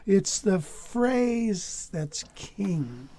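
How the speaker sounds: very slow, each word drawn out; slow and deliberate. Shown as a demonstration. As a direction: spell it out slowly for the student. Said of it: "S-, say it".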